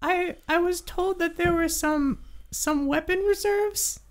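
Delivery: high, squeaky little creature, halting; nervous kobold. Acted. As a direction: explain haltingly in a tiny kobold voice. Game master voices kobold.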